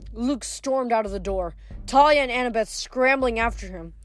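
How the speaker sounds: usual speaking voice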